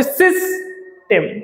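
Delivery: সিস্টেম split into two slow beats, সিস then টেম; syllable by syllable. Shown as a demonstration. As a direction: say সিস্টেম in two separate parts. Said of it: "কয়ভাবে তুমি pronounce করো". (দুই ভাগে ভেঙে ধীরে সিস্টেম)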